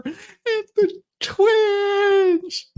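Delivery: fake-sob it out for laughs; mock wail. Mock cry over dramatic reading.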